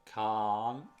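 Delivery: "can" with a long open rounded A, as in can't without its T. Shown as a demonstration. Teacher demonstrating the negative form, long rounded "can". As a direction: draw out the vowel of "can". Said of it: "El A es mucho más redondeado".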